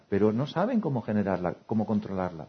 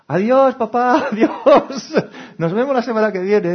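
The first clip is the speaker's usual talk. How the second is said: much higher, louder goodbye through laughter; gleeful goodbye; 13 semitones higher, 12 dB louder, slower than usual. Retold or imitated. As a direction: wave dad off, laughing with relief. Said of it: "Si ponen cara muy feliz cuando". (despedida feliz entre risas, mucho más aguda y fuerte)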